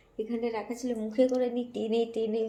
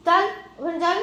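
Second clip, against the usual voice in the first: loud high shoo; loud high shoo at the cat; 8 semitones higher, 9 dB louder than usual. Real, not acted. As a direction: chase it back to its spot. Shooing cat away.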